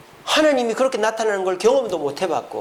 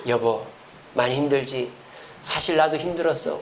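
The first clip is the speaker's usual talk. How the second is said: lower, gentle acted sympathy; tender confiding; 5 semitones lower, 4 dB quieter than usual. Husband holding his wife's hand, tenderly confiding. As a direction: comfort a spouse while holding their hand. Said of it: "손을 꼭 잡고".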